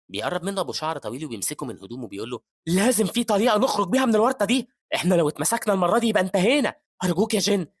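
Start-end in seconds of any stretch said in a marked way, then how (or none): 2.7-7.7 high, loud panicked demand; desperate pleading. Acted. Long-haired man grabs the hero, desperately pleading for a way out. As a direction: beg and threaten in loud desperation.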